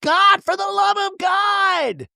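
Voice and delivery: high drawn-out shriek, slowed; hammed-up meltdown scream; screaming nonstop. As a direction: wail it at the top of your voice. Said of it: "she's screaming nonstop".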